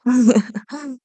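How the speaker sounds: a short rising villain's giggle; delighted laugh. Acted. Villain laughing as the hunter weakens. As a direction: laugh at their helplessness.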